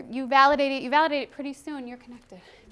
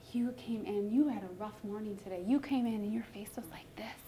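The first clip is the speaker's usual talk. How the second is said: soft, lower, gentle acknowledgment; validating; 4 semitones lower, 8 dB quieter than usual. Acted. Teacher to a child after a rough morning, soft validation. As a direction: speak gently to settle an upset child. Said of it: "then I'm validating".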